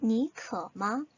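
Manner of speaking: 니可마 drawn out very slowly, tone by tone; slow careful Chinese demo; very slow Chinese model. Shown as a demonstration. Teacher models the question very slowly for learners. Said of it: "너 목마르니".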